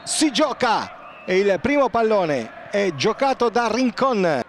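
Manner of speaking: Italian football commentary; live play-by-play. Acted. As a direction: call the action live, building to the post. Live play-by-play, post hit.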